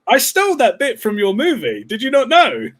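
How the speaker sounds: Spielberg impression. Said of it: "I was like".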